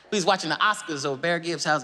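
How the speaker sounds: ordinary talk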